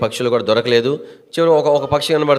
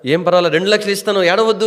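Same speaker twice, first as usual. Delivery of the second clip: higher-pitched, quick merchant's offer; urgent reassuring offer; 7 semitones higher, faster than usual. Acted. Merchant to the crying hunter, urgently reassuring. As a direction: hurry to stop the tears with a raised voice.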